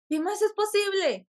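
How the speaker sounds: high-pitched, delighted question; retold thrilled wonder. Retold thrilled reaction after court. (pregunta ilusionada con voz aguda)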